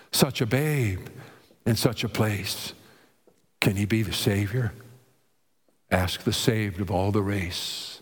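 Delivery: low, measured poem reading; solemn low-pitched recitation; recited hymn verse. Acted. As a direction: recite the hymn lines deep, pausing between them. Recites song lyrics as verse.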